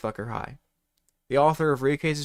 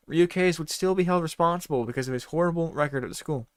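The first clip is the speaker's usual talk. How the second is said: ordinary talk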